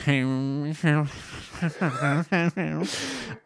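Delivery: long, hammed-up villain cackle; evil cackle. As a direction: cackle evilly to yourself in a silly villain voice. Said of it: "sort of evilly cackles to himself".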